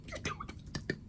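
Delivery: whispered very high and soft; tender whisper. Real, not acted. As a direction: hush it like a secret comfort. Consoling the dead character.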